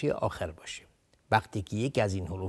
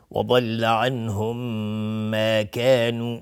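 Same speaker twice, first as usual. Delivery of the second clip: slow, louder recitation with a held nasal m; Quran recitation; 6 dB louder, much slower than usual. Shown as a demonstration. Teacher demonstrating the merged m, slow Quran recitation. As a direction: recite slowly, holding the merged m. Recites the verse being taught.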